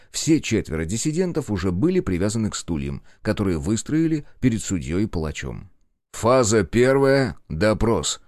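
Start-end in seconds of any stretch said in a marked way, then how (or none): 6.1-8.2 медленно и чётко, как для протокола; объявить этап допроса официальным тоном; официально, под протокол. A narrator's reading. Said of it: "под протокол произнёс король". (официальное объявление)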